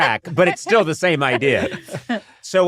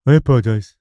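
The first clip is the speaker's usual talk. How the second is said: mimicking her voice; 3 semitones lower, 4 dB louder, faster than usual. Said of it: "You just hear".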